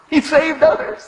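loud, high scornful jeer; scoffing. Retold or imitated. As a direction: sneer it at full voice. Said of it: "they scoffed".

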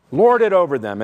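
loud reading, LORD hit hard; forceful scripture quote. Shown as a demonstration. Preacher quoting Jesus on Gentile rulers, forceful. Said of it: "the emphasis is on coming down".

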